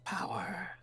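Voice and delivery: high breathy whispered villain line; Loki whisper. Retold or imitated. Practicing a Loki voice for a new video, whispered. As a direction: whisper it like a scheming god. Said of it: "I went to do Loki".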